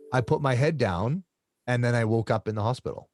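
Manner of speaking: normal talking voice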